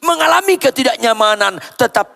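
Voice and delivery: high, loud sermon cry; impassioned, ringing preacher; preaching at full force. Real, not acted. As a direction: exhort the congregation from the top of your voice.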